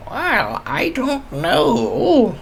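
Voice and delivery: put-on high, slow old woman's voice; drawn out, high and unsure like an old lady. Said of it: "She looks down and goes".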